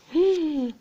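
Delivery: ordinary delivery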